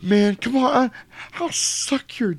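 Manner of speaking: high, slow pleading squeal; high wheedling voice with a laugh; begging whine. Retold or imitated. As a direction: beg for your life, hammed up. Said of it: "he goes".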